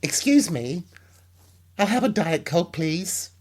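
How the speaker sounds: high, light imitation of the man's voice, not the expected macho one; soft high voice. Imitating the big man's unexpectedly high voice ordering a Diet Coke. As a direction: lift the pitch into a dainty, light voice. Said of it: "what came out was".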